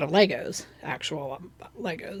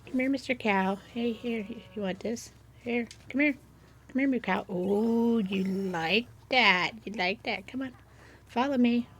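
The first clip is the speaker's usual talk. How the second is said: higher, playful pet-calling voice; coaxing an animal; 3 semitones higher than usual. Pretend. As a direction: lure a cow along, sweetly pretending it listens. Coaxing a game cow to follow.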